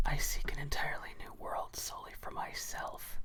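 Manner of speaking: hushed, whispered villain quote; Cyrus voice. Acted. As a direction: whisper it like a brooding edgelord. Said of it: "hearing my Cyrus voice".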